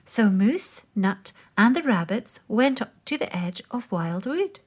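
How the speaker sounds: ordinary delivery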